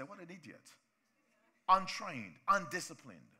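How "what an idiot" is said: quiet scornful aside; muttered verdict. Retold or imitated. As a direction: say it under your breath with scorn. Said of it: "We just say".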